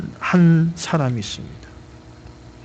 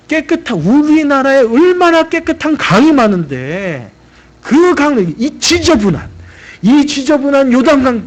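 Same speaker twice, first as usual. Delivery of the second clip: higher and louder, scoffing at the muddy river; an offended general spitting out his contempt; indignant general; 8 semitones higher, 4 dB louder than usual. Retold or imitated. Naaman scorning the Jordan to the crowd, indignant and loud.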